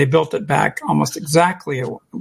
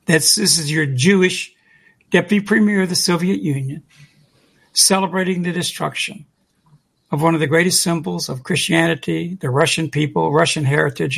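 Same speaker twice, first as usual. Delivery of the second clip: ordinary talk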